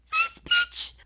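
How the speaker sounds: sudden very high shriek of outrage; outraged squeal. Real, not acted. Reacting to the ex showing up, outraged squeal. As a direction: squeal it in shocked indignation.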